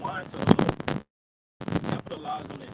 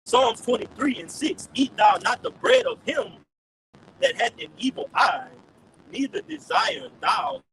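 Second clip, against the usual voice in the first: loud, slow, declaimed verse; scripture reading; 5 dB louder, slower than usual. Real, not acted. Brother reads the first scripture on request, loud declaimed reading. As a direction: proclaim the scripture slowly and forcefully.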